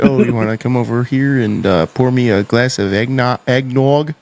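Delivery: imitating wife Canadian. Retelling wife's offer, light Canadian voice. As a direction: mimic her Canadian hosting. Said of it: "she's like".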